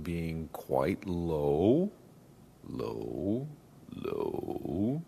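deep chest voice, slow and low. Shown as a demonstration. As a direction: drop the voice down into the chest. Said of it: "if I speak a little bit lower".

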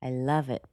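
"I love it" said softly, without a final rise; no upward intonation. Shown as a demonstration. Last answer of the practice conversation, no upward intonation. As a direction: quietly settle "I love it" downward. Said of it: "except for the last sentence".

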